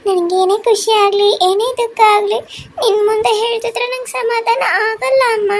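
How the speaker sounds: put-on high, slow child voice, near tears; tearful little daughter. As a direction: pour your heart out to mother in a high child voice. (ಅಳುಮುಖದ ಪುಟ್ಟ ಮಗಳ ಎಳೆಯ ಧ್ವನಿ)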